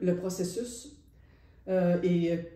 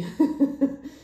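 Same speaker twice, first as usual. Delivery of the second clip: delighted laugh; 6 semitones higher, 5 dB louder than usual. Real, not acted. Delighted talk about creative freedom.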